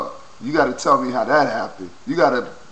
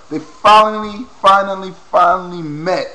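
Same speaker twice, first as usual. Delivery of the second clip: loud, higher, thrilled repeats; excited; 7 semitones higher, 10 dB louder than usual. Real, not acted. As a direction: hammer finally with joy.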